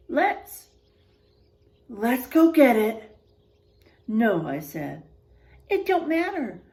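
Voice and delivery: expressive storybook voice, quotes acted out; reading to children. A narrator's reading. Teacher reading a spooky picture book to the class, expressive. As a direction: read it like a spooky bedtime tale for kids.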